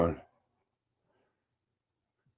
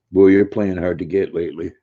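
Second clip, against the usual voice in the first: ordinary talk, nothing marked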